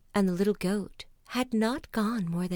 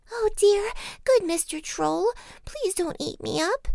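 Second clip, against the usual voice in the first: high, pleading little-goat voice; frightened little goat; 13 semitones higher, 5 dB louder than usual. A narrator's reading. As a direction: beg the troll in a tiny squeak. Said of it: "he was very much frightened".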